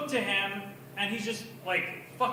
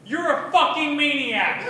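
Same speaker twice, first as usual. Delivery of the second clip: a retold yell, only a bit louder; mock yell; 4 semitones higher, 6 dB louder than usual. Retelling yelling at an eight-year-old, mildly raised. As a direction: blurt it out at the kid. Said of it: "I yell this aloud".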